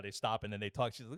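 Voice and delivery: ordinary talk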